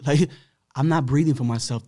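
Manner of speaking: normal talking voice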